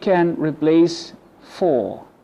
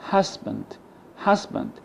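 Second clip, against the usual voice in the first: "husband" as HUS-bund; first-syllable stress; 5 semitones higher, 4 dB quieter than usual. Shown as a demonstration. Teacher shows an unstressed schwa, "husband" stressed on HUS. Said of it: "the first syllable is stressed".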